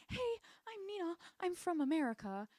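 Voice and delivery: high-pitched mock newcomer introduction; perky newcomer. Nina introducing herself in Joy's spot, mock perky. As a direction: lift it high, like a hammed-up new girl.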